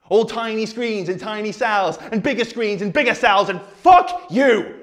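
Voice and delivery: high rant exploding into a shout; building fury. Acted. Speaker turning on the audience, furious outburst. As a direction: build the list, then shout the curse.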